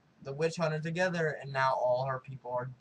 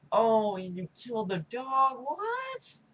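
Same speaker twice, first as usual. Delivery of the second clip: high-pitched, outraged dismay; mock disbelief; 10 semitones higher, slower than usual. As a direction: shoot up to a squeaky, incredulous question. Acted outrage at dog being killed.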